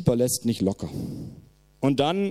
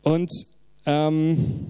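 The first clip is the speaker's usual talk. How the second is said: ordinary delivery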